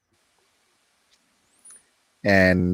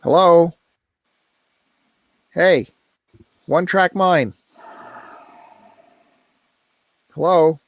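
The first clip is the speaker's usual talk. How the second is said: high, raised calls into dead air; calling out; 7 semitones higher, slower than usual. Real, not acted. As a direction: call out for the silent co-host's attention. Calling to a co-host who stays silent.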